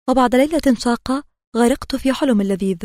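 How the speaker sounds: usual speaking voice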